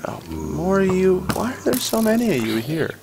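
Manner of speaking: high, hushed whisper. Real, not acted. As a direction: breathe it out under the breath. More mobs appear.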